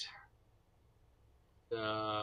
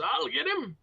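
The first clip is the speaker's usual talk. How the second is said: high, raised cry from the ground; attacker's cry; 13 semitones higher, 4 dB louder than usual. Acted. Game master voices a downed foe, high eager cry. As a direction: call it out high and loud. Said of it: "The one on the ground says".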